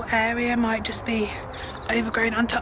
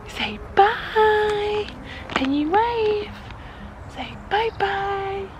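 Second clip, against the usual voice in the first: put-on high cooing baby talk; 9 semitones higher, much slower than usual. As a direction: coax the baby to wave. Prompting baby to wave.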